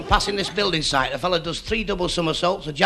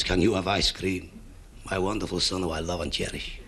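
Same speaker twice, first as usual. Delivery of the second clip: doting father; 11 semitones lower, 8 dB quieter than usual. Retold or imitated. Father dotes on little son.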